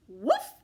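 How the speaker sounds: loud, short woof; dog bark. Acted. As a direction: bark it out instead of a letter. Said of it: "I say".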